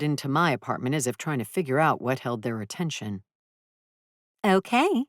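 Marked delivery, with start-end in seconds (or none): 4.4-5.0 high chirpy okay; bright young woman. A narrator's reading. Renter agreeing to Bolin, high and chirpy.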